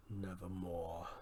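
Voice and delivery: raven's reply. A narrator's reading. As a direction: croak it slow and low like the raven. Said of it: "Then the bird said".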